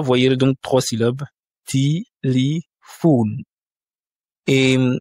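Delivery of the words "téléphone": téléphone very slow: ti-li-FON; téléphone drawn out, E's said as I; slow syllable by syllable. Shown as a demonstration. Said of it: "Les deux yens ne sont pas accentués".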